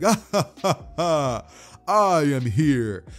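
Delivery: booming heroic laugh, then grand declaration; loud, stretched superhero entrance; All Might. Acted. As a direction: announce yourself like a triumphant hero.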